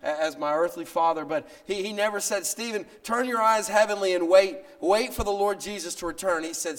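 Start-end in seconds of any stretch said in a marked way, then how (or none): 0.0-2.5 louder, rising preacher's cry; fervent preaching. Acted. Pastor preaching with rising force. 2.5-6.4 louder, higher ringing quote of father; forceful quoted father. Retold or imitated. Father's words said never spoken.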